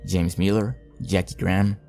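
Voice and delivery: ordinary talk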